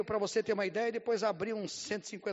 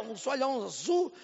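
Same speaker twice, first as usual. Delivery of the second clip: normal talking voice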